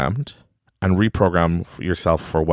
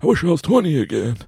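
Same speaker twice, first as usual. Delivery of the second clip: lightly hammed-up aging groan, pitch climbing higher; mock complaining old person; 5 semitones higher than usual. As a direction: whine like someone who feels old, half mocking. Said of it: "Not what we see in movies".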